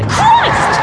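sudden high yelp of alarm; shocked shout. Acted. Driver shrieks as she hits someone. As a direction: shriek as something hits the car. Sudden crash while driving.

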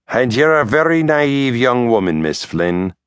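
cutting, condescending retort; sharply. A narrator's reading. Lawyer hitting back at Mary Catherine, sharp. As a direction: snap back with cold scorn. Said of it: "the lawyer said sharply".

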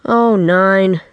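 theatrical dad's complaint; moaning. A narrator's reading. Dad over supper longing to quit, moaning. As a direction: moan it out, lightly. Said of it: "he would moan".

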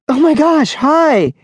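high, loud, thrilled burst; gushing. Acted. Honey meets Tadashi's little brother, gushing with delight. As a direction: gush with delight at meeting him. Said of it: "she gushed".

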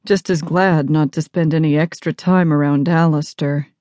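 plain reading voice

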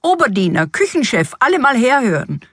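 joyful summons to the staff; overjoyed calling out. A narrator's reading. King summons his household for the feast, overjoyed call. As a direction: call everyone over in delight. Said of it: "sich vor Freude nicht zu fassen wusste und rief".

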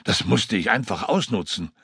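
excited pilot reliving the fall; Joskun recounting. Acted. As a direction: tell the crash story in a rush. Joskun explains his flight.

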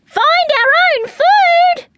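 high, loud, drawn-out disbelief; shriek. A narrator's reading. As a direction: shriek it like an outraged baby bird. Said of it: "shrieked Lara".

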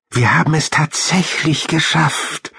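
put-on high-pitched young hamster voice; small high voice, marvelling at making it; awed little hamster. A narrator's reading. Hamster tells friends they made it, high marvelling voice.

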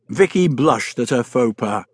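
usual speaking voice

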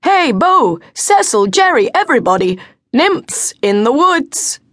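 high, loud call to his friends. A narrator's reading. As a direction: shout for the others to come and see. Young man calling friends over.

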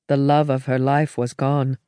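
usual speaking voice

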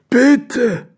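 high, altered little voice; pleading badger voice. Rolfi the badger begs for Meinrad's umbrella, high plea. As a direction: beg in a high squeal.

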